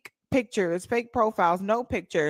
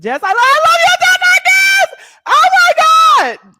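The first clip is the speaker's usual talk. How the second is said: much higher and much louder, laughing shout of joy; excited shout; 19 dB louder than usual. Real, not acted. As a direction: scream it out in delight at her friend. Excited greeting of a viewer.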